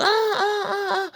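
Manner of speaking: high mock moans; high moaning. Moans from the porn corridor rooms, high mock moaning. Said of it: "gauche, droite".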